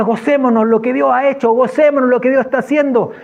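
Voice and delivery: rally the group to rejoice; joyful exhortation. Retold or imitated. Man urging his group to rejoice in the rain, exuberant.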